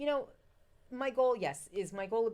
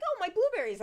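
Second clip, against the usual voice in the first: much higher, louder delighted cry; excited exclamation; 14 semitones higher, 10 dB louder than usual. Retold or imitated. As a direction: burst out in delight. Excited recalled reaction.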